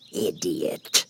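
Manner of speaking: a curt, dismissive Queen; impatient Queen. A narrator's reading. The Queen to the bowing Knave, curt impatient scorn. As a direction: toss the word off with contempt. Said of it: "tossing her head impatiently".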